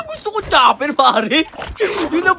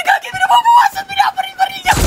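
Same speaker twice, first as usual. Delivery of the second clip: panicked high shout. Real, not acted. Under fire from an enemy.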